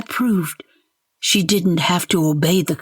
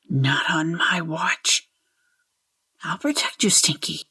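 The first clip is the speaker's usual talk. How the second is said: quiet vow; 5 dB quieter than usual. A narrator's reading. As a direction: murmur a protective promise to yourself.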